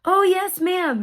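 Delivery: high, lilting eager courtesy; so nice. Retold or imitated. Attendant confirming to mom, sweetly courteous. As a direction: imitate a very obliging attendant. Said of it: "He was, like, so nice".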